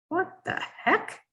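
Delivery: a startled, incredulous squeak; baffled disbelief. Retold or imitated. Seeing the fax come in, a high startled squeak. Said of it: "And I'm like".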